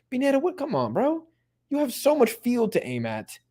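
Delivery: can't believe he wasted that; exasperated. Real, not acted. Streamer scolding Pineda on screen: high exasperated disbelief. Frustrated at a missed chance.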